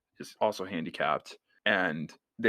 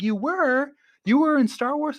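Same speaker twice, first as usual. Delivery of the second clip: very high, louder squeal of surprise; mock amazement; 5 dB louder than usual. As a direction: squeal it like a starstruck mom. Mom voiced amazed.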